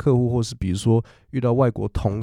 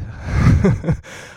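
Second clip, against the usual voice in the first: breathy laugh; 6 semitones higher than usual. Real, not acted. Laughs after the story. (轻声气音笑)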